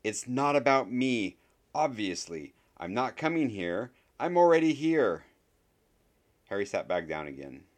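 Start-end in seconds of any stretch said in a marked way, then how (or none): 0.0-5.3 raised voice, high and emphatic; loud, exasperated announcement. A narrator's reading. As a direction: call out over a room full of chatter. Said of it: "said very loudly over the conversations".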